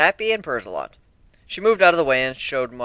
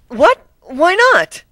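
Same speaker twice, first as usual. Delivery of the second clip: very high, loud whiny pleading; pouting plea; 10 dB louder, much slower than usual. Acted. Twilight begging to see more magic, high whiny pout. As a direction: pout and beg. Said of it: "She pouted and had pleading eyes".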